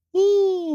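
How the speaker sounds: high, loud yelp of pain; pained whoop. Retold or imitated. Yelping as the piercer sticks the needle in. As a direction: cry out as the needle goes in.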